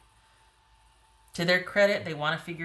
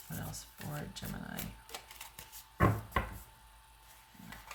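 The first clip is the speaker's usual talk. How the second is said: crackly voice; 9 dB quieter, much slower than usual. Real, not acted. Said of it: "so I'm getting crackly".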